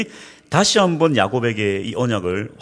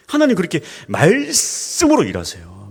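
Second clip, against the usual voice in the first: normal talking voice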